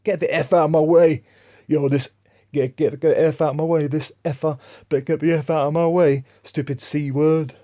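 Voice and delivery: hammed-up, high-pitched irate shout; imitated angry shouting. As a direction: mimic a driver yelling out the window. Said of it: "while shouting out the window".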